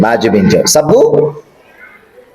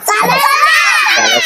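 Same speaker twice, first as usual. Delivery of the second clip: "సల" yelled very high and long. Real, not acted. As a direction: squeal the word out. Child shouts drill word. (పిల్లవాడు గట్టిగా అరవడం)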